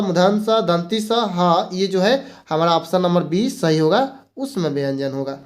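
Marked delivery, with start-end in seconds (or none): 0.0-1.7 दंती स, हा खींचकर धीरे; हर ध्वनि अलग करके धीमे; slow letter reading. Shown as a demonstration. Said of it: "sorry, तलाबे स". (दोबारा गिनाते शिक्षक, धीमे)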